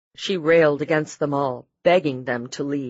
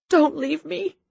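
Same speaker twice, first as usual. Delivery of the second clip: high desperate plea; pleading; 12 semitones higher than usual. A narrator's reading. Wife pleads with her dying husband, high and desperate. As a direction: beg him not to go. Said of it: "she pleaded".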